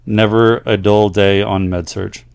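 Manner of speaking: letter-reading voice; reading a letter aloud. Acted. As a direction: read the listener's story out to the audience. Reads a listener's letter aloud.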